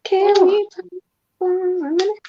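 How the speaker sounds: high, put-on dramatic "Can we talk"; high mock voice. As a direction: pitch it way up like a playful diva line.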